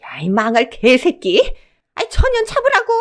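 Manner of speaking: high, loud screech of a furious mother-in-law; enraged screaming. A narrator's reading. Mother-in-law sets her dog on the fleeing daughter-in-law, enraged screaming. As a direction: scream it out in vicious rage. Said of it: "악에 받쳐 고래고래 소리 지르는 시어머니의 목소리".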